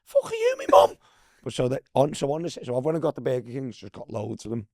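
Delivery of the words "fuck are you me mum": loud, very high indignant imitation; hammed-up high-pitched telling-off; mock outrage. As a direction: mimic an outraged retort at full pitch. Repeats teammate's indignant outburst.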